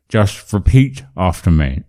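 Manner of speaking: muffled sibilants round a pen in the teeth; pen in mouth. Shown as a demonstration. Coach leading the pen drill, mouth obstructed. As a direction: speak with a pen held in the mouth. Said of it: "put it in your mouth like this".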